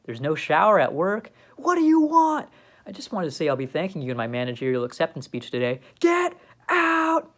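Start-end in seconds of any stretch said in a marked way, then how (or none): 1.6-2.5 angry. Acted. Squidward angry at the door. 6.0-7.3 angry yell, high and loud; shouting. Acted. Squidward throws him out.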